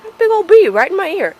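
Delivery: high, loud shriek of alarm. Real, not acted. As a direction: yelp it out in panic. Bee flies into her ear.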